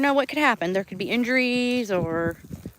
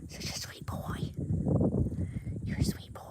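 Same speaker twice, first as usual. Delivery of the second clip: whisper; 8 dB quieter than usual. Real, not acted. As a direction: breathe the words tenderly. Whispering to pet.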